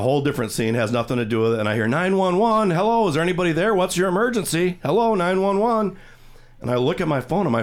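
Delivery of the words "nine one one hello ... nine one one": 911 operator. Retold or imitated. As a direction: call out like a dispatcher with no answer. 911 operator heard from phone.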